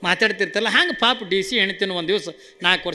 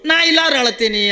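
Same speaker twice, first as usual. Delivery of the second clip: higher, louder Birbal playing a bawling child; mock child crying; 5 semitones higher, 4 dB louder than usual. A narrator's reading. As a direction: bawl it like a spoilt kid. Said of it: "ಅತ್ತು". (ಮಗುವಿನಂತೆ ನಟಿಸಿ ಅಳುವ ಬೀರ್ಬಲ್)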